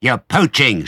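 high, loud accusation; shrieking rat. A narrator's reading. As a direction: screech it like an outraged rat. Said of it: "shrieked Rat".

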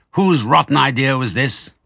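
loud grumpy demand; irritated. A narrator's reading. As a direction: complain sourly. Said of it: "tired and cross".

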